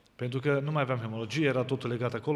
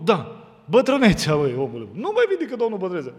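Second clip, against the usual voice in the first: teasing loud reply; 6 semitones higher, 7 dB louder than usual. Acted. Joking reply to father-in-law.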